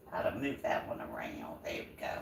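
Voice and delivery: hushed murmur to herself; whispered aside. Real, not acted. Vlogger cooking pasta, whispering to herself about the pan. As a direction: whisper while fiddling with the pan.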